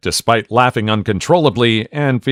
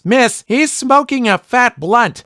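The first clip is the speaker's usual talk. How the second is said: a first grader blurting it out at the screen; kid shouting; 12 semitones higher, slower than usual. Retold or imitated. Child calling out to teacher, mock shout. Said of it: "the kid shouts".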